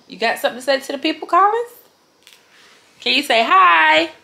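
put-on fast high coaxing baby talk; talking to a toddler. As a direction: coax a baby to say hi. Prompting toddler for camera.

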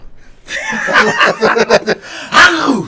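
loud, high sneeze-like made-up name; mock sneeze-like name. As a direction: sneeze out a silly name, laughing. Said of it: "Bless you".

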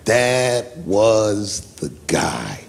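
very slow, word by word; weighty, hushed slow declaration; solemn emphasis. Real, not acted. Dramatic conclusion about the candidate.

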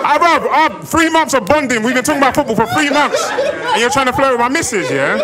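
high-pitched betrayed protest; indignant shouting. Retold or imitated. Confronting the driver who flirted with his missus, indignant shouting. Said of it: "I'm shouting on my phone".

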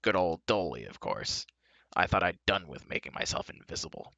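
low, grumbling sarcasm; muttering. A narrator's reading. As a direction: grumble it to yourself, pitch down. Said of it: "muttered the dwarf".

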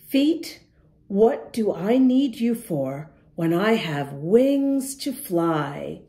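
normal talking voice

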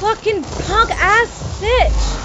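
drawn-out, high angry yell; furious slow yell. Real, not acted. Gamer cursing the player who ambushed him, furious slow yell. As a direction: spit each word out slowly in rage. Enemy attacks him again.

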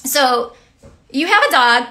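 ordinary delivery

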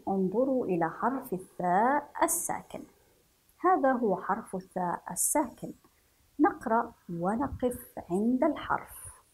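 ordinary talk

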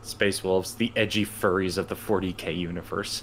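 deep mock-announcer voice; low deadpan.

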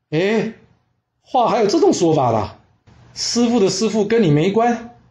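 high-pitched incredulous questioning. Acted. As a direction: echo it back in disbelief, rising at the end. 师祖听了徒孙的话感到诧异. (难以置信地高声反问)

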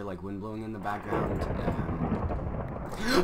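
ordinary delivery

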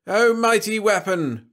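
high, slow, loud hailing cry; drawn-out ceremonial shout; bawled. A narrator's reading. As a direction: bawl it out to a mighty master. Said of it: "bawled Trescu".

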